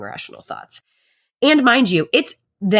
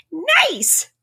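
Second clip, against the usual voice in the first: quoting a viral "Nice!" sound, pitched up; chirpy sound-bite imitation; imitating audio clip; 12 semitones higher than usual. Mimicking the [name] Instagram audio, mock chirpy. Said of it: "the Instagram audio that's like".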